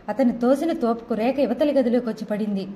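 plain reading voice

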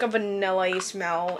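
ordinary talk, nothing marked

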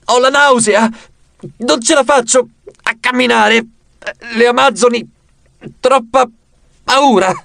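high, halting queasy whine; feigned seasickness. Pretend. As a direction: fake being sick, gasping out a few words at a time. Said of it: "tentando di simulare il più possibile". (finto mal di mare, a fatica)

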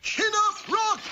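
high, loud shout of encouragement; loud cheering shout. Acted. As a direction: yell it out to cheer him on. Calling to the passing cart.

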